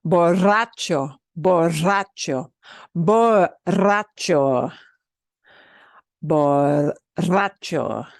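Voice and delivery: borracho said slowly with a drawn-out rolled rr; slow demo. Shown as a demonstration. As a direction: take borracho slowly, syllable by syllable. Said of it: "It's hard for when I go slow".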